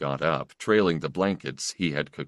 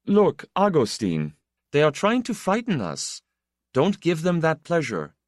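firm encouragement; 11 semitones higher, 3 dB louder than usual. Acted. Steadying his frightened friend.

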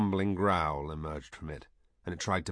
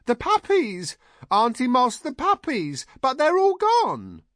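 very high, loud alarmed outburst; high-pitched excitable yelling; shouted; 8 dB louder than usual. A narrator's reading. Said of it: "and then shouted".

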